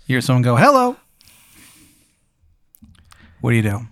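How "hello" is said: high, bright-toned greeting call; high sing-song hello. Acted. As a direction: sing out hello from behind the door. GM voices visitor at door.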